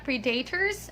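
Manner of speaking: "predators" as pree-DAY-ters; wrong pronunciation. Shown as a demonstration. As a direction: say "predators" with long EE and a stressed DAY. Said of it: "it's not predators".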